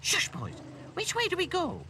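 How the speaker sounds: loud shush rising very high; flustered. A narrator's reading. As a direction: hush the boys, then ask in a fluster. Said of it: "Ethel began to get flustered".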